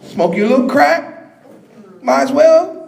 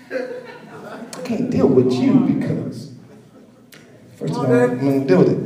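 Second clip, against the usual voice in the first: scoffing imitation; 11 semitones lower, 5 dB quieter than usual. Imitating scoffer, laughing, low.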